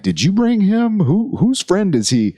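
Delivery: high-pitched puzzled asking; incredulous questioning. Retold or imitated. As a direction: question who invited the stranger, voice way up. Acted quote questioning an unknown guest.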